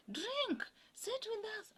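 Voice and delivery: very high, drawn-out calls of invitation; imitated eager welcome. Kenyans invite her to sit with them, high imitated eager welcome. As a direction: mimic people excitedly calling her over.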